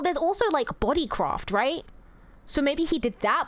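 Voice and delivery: ordinary delivery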